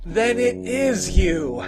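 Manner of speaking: joyful, drawn-out recognition; overjoyed. Acted. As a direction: realise it with delight.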